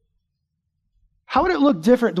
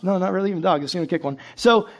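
normal talking voice

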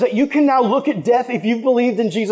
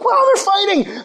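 surprised; 12 semitones higher than usual. Retold or imitated. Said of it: "I'm like".

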